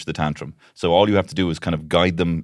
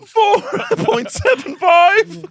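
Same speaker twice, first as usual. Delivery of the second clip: hammed-up, squealing slow count, much higher and louder; panicking counting parent; 6 dB louder, much slower than usual. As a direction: drag out the numbers like a parent losing control. Parent stretching the count to five.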